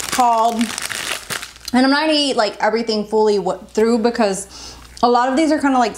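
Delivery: normal talking voice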